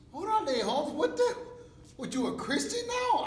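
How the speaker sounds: loud, high-pitched jeering disbelief; mock incredulous homies. Homies to newly converted inmate on the yard, loud mock disbelief. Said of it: "and the homies are like".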